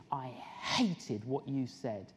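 low, slow retold hostility; mock rudeness. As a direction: imitate a rude girl, drawing it out low. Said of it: "interrupted us rudely".

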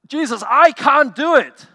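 high, loud, slow anguished plea; a loud, drawn-out wail of helplessness; desperate cry. Acted. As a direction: cry out like someone at the end of their rope. Believer's desperate cry to Jesus.

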